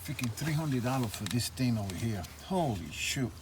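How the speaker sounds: normal talking voice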